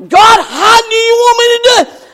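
high, loud, fast pleading cry; pleading to God. Acted. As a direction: call up to God, urgently. Acted plea to God.

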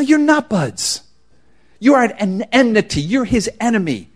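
ordinary delivery

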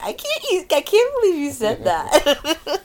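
high-pitched, giggly retold incredulity; mock disbelief. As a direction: squeal it in mock shock, tipping into laughter. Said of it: "I'll make comments to him afterwards".

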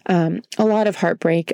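ordinary talk

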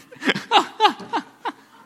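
high, loud burst of laughter; preacher cracking up at his joke; big laugh. Real, not acted. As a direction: laugh out hard.

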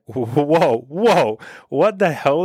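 mock shock. Reacting to a stranger's insult after a friendly hello, mock shock. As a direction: act mildly stunned, pitch jumping up.

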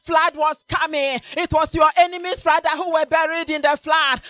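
loud, shouted sermon over music; full-force preaching. Acted. Preacher shouting to the congregation, full force.

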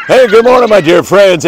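bright, high hello; big warm hello to viewers; cheerful greeting. Real, not acted. Upbeat show greeting.

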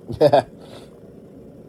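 loud amused laugh. Real, not acted. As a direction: burst of real laughter. Laughs at own dramatic line.

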